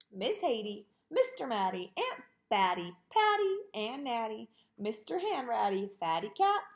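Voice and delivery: ordinary delivery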